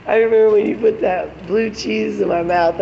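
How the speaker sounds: high, loud, lively imitation of him; a gleeful retelling in his voice; excited reminiscing. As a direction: mimic him teasing her about it. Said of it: "He's like".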